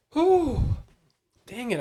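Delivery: usual speaking voice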